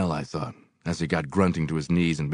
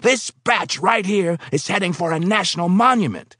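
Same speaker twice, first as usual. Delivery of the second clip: higher, louder foreman voice; boss voice; 13 semitones higher, 5 dB louder than usual. Acted. Foreman bragging to the new worker about his paint. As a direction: voice the pushy paint boss, raised and proud.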